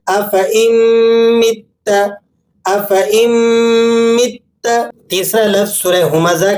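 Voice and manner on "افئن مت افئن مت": hamza joined to مت with ghunna; افئن, pause, مت rising; correct recitation. Shown as a demonstration. Teacher models the right reading, measured recitation. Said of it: "کس طرح سے؟".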